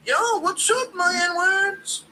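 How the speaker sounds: high, mock Ian Gary trying to sound cool; Gary code switching. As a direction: talk high and eager like a try-hard. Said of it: "Ian Gary code switching".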